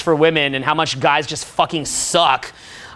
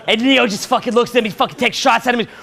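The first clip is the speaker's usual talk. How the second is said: usual speaking voice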